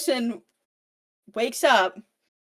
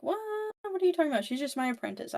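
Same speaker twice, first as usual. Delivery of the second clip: high, defensive disbelief; mock flustered denial; 9 semitones higher, 4 dB quieter, much faster than usual. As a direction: deny it too fast. [name] denies being in love.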